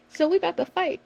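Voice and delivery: mock fight threat. Joking threat.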